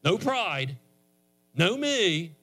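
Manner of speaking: high-pitched, impassioned pulpit delivery; fervent preaching. Real, not acted. Preacher tells congregation how you get saved, fervent and high-pitched. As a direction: preach it with rising urgency, pitched well up. Preaches salvation and models prayer.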